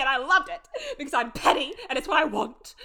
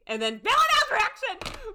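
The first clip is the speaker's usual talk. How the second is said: excited; 13 semitones higher, 5 dB louder than usual. Real, not acted. Excited about the scene.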